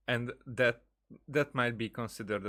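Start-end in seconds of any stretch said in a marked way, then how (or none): none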